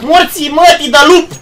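furious shout at a teammate; angry yell. Real, not acted. Gamer yelling at a teammate who messed up. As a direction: bark the curse out loud.

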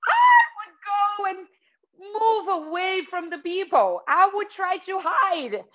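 high-pitched turkey escaping, hammed up for kids; pretend frightened turkey. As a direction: play a turkey running off to hide. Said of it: "What would you do if you were a turkey?".

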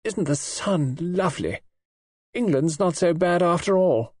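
voluptuous. A narrator's reading. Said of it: "said Kay voluptuously".

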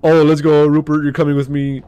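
loud, high jokey cheer; excited loud rally. Real, not acted. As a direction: hype the dead buddy up. Picks up the corpse jokingly.

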